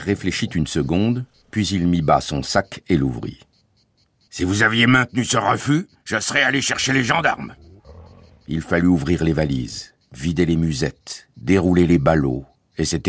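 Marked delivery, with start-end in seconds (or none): 4.3-7.5 high, self-important guard's voice; official threat. A narrator's reading. Guard warns father about the gendarmes, menacing. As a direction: threaten with puffed-up authority.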